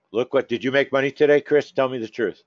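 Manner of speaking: ordinary talk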